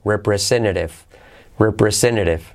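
"Representative" with first T dropped, second tapped; soft-R T. Shown as a demonstration. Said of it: "la segunda T como R suave".

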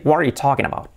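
linked English, "wha-ree TAW-kin aba"; reduced, fluent native English accent. Shown as a demonstration. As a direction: swallow the endings like a native. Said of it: "Se puede decir".

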